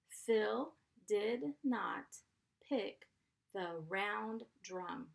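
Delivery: slow, each chunk split with pauses; teacher reading for spelling practice; slow dictation. Shown as a demonstration. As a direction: dictate it slowly in parts.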